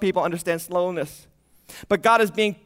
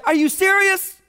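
disbelief; 8 semitones higher than usual. Retold or imitated. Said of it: "you're like".

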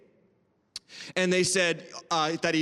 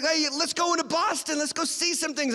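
mock-eager friend, pitched higher; excited visitor; 8 semitones higher than usual. Visiting friend to host about seeing Boston, imitated excitement. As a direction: imitate an eager tourist in a high voice. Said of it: "He's like".

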